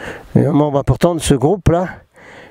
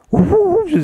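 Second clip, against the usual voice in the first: very high, comic "ooh" hooting; playful high hoot. Pretend. Teasing the viewers who are concerned, playful high hoots.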